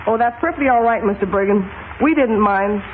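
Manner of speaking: ordinary delivery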